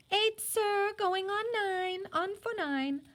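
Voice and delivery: put-on high, eager small girl's voice; little girl. Little Isana answering the judge in a high childish voice. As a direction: pipe up like an eight-year-old.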